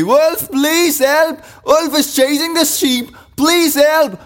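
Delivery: very high, drawn-out cry for help; loud cry. Acted. Boy seeing a real wolf, high cry for help. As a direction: plead for help in a high, urgent call. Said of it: "and cried loudly".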